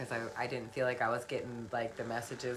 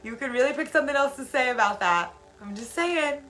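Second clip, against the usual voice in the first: high, loud sassy advice; playful scolding, pitched way up; mock advice; 10 semitones higher, 8 dB louder than usual. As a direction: tease them in a much higher voice. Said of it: "I'm like".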